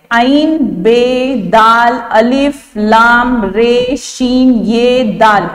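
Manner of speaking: slow, spelled-out letter names; name each letter slowly for the class; slow letter spelling. Shown as a demonstration. Teacher spells Abdul Rashid letter by letter, slow and deliberate. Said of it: "इन हुरूफ का इस्तेमाल किया गया है".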